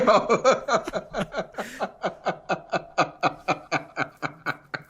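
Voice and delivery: long, stuttering run of laughter; long real laughing fit. Real, not acted. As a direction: keep laughing until it tapers off. Cracks up at the story.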